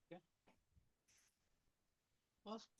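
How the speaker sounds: ordinary delivery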